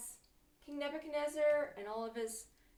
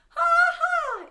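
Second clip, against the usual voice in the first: very high, shouted "ha ha"; big performed ha-ha for kids; loud sung-out laugh; 20 dB louder than usual. Shown as a demonstration. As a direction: belt out a pretend laugh. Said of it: "laugh out loud".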